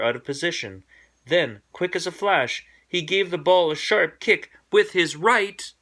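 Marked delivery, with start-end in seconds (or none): 1.3-5.7 rising, louder, racing the words out; excited build. A narrator's reading. Narrator telling the sharp kick, excited. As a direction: thrill of a quick kick.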